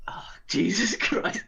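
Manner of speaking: breathless laughing whisper. Real, not acted. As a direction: whisper through giggles. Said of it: "I can't stop laughing".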